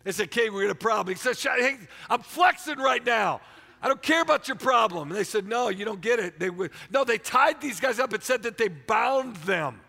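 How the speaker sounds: loud, high, fervent preaching; a preacher roaring the story of the furnace; full-force preaching. Acted. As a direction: preach it hard to the crowd.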